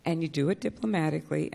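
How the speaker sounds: normal talking voice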